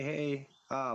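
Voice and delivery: ordinary talk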